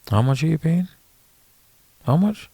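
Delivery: high, sharply rising questions; pointed questioning. Shown as a demonstration. Caddy pinning down the player on pay, high rising questions.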